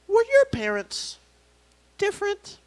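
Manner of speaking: high-pitched prying question; coworker lady. Retold or imitated. Coworker lady probes his background, high and delicate. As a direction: ask it high, with careful pauses. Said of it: "this lady, after a meeting".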